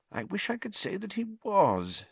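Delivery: measured, high-pitched regret; slow. A narrator's reading. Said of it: "said Fudge slowly".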